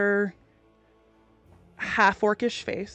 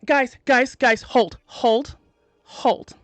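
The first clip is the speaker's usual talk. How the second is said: loud urgent call to stop; urgent shout; 4 semitones higher, 5 dB louder, slower than usual. Acted. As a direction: shout to hold them back. Stopping companions in alarm.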